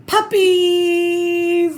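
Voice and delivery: high, loud delighted shout; excited cry. Acted. Reader revealing the puppies to the child, loud and high. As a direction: burst out with the big reveal.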